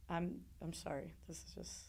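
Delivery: very soft, faltering apology; real choked-up hush; getting emotional. Real, not acted. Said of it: "I get emotional".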